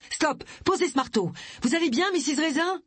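high, urgent policeman's shout; urgent command. A narrator's reading. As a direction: call a sharp halt from a distance. Policeman shouts to stop the hammering.

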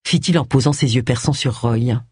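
ordinary delivery

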